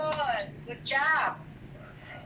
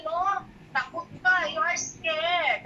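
ordinary talk, nothing marked

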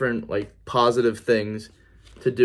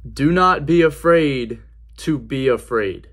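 slow, louder, each word weighed; awed slow reading; 3 semitones higher, 6 dB louder, slower than usual. Retold or imitated. Reading the day's verse aloud to viewers, slow and louder. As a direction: read it out slowly, stunned. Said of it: "I read that".

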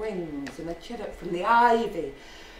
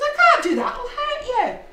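very high, loud mock alarm; acted dismayed protest; 8 dB louder than usual. Jock refusing to hurt the bird, high-pitched alarm. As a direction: squeal it in hammed-up dismay.

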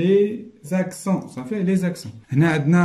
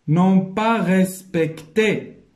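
very slow and louder, syllable by syllable; slow dictation reading; 5 semitones higher, 4 dB louder, much slower than usual. Shown as a demonstration. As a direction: spell it out by ear for learners. Teacher reads out exercise phrase. (قراءة إملاء بشوية وبصوت عالي)

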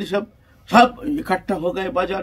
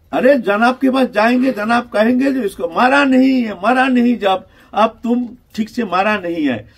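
high, loud worried outburst; acted alarm at the master's coming blame; executioners' panic; 7 semitones higher, 8 dB louder than usual. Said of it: "भय के मारे".

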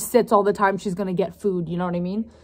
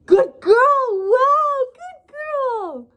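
high, loud squeaky praise; squeaky praising a dog; 4 dB louder, much slower than usual. Real, not acted. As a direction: gush over the puppy, way up high.

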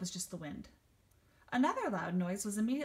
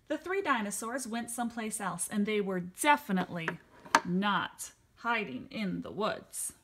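ordinary delivery

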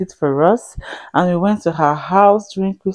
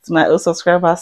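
ordinary delivery

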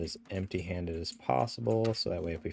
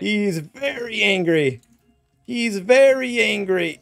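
slow, high, loud alarm; high-pitched yelling under attack; panicked shout; 12 semitones higher, 10 dB louder, slower than usual. Real, not acted. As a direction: wail a drawn-out warning to teammates. Angry bear attacking the players.

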